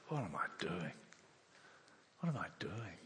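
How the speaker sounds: low, hushed realisation; quiet self-questioning. Acted. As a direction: ask yourself softly, pitch dropped. Voicing the prodigal's realisation.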